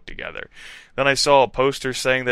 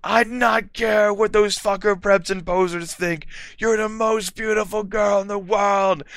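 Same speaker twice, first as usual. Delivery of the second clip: Draco; 9 semitones higher than usual. A narrator's reading. Draco's love declaration.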